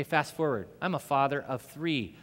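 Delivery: usual speaking voice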